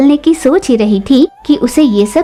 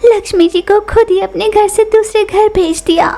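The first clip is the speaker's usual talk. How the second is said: much higher, wailing regretful voice; lamenting Lucy; 8 semitones higher than usual. Acted. Pigeon bewailing her lost luck, high tearful lament. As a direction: bemoan lost fortune, half crying. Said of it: "रोने लगी".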